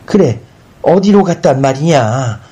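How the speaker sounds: high, urgent demand of the son; mother asking, worked up; agitated questioning. A narrator's reading. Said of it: "영산이 나서 묻는다". (다급하게 다그쳐 묻듯)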